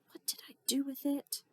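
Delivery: a breathless whisper, searching the pile; whispered to herself. Acted. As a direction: whisper as if thinking aloud. Mom searching, muttering to herself.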